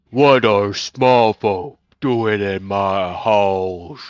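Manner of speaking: very slow, drawn-out giant voice; obese giant. Acted. Giant on her throne questions small intruders, slow giant voice. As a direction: speak as a huge giant, stretching every word. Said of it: "obese giant say".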